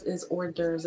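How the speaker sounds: ordinary talk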